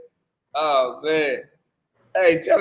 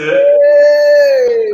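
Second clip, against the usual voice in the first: playful squeal; 8 dB louder than usual. Real, not acted. Reacts to little barista line.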